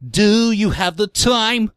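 high, slow nasal-free whine, sung; whiny singer impression. Mocking the Green Day singer for friends, high and whiny. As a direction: ham up a whiny punk frontman. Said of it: "got this whiny voice".

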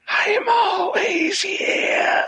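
slow, high ghostly wail; haunt them from the dark; unearthly voice. Acted. Creepy caller's ghostly call.

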